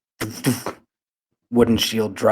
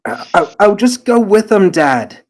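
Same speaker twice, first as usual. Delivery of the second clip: put-on boy pleading; 10 semitones higher, 10 dB louder than usual. Son character pleads with father.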